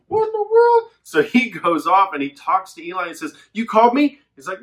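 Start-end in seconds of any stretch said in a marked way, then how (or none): 0.1-0.9 high startled squeak. Retold or imitated. Boy Samuel waking confused in the night, high and startled. 3.5-4.2 put-on higher, eager boy asking; eager child. Little Samuel asking the priest, higher and eager.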